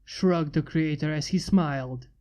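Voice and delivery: plain reading voice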